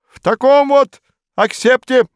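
high, loud grand pronouncement; majestic. A narrator's reading. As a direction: proclaim it grandly. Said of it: "величественно произнёс Модест Матвеевич".

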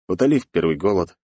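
ordinary delivery

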